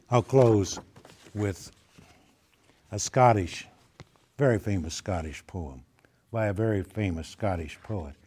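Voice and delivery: ordinary delivery, nothing marked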